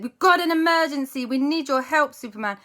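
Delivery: high, loud urgent request; urgent plea. Acted. Aunt Lisa begging Superman for help, urgent. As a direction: sound the alarm.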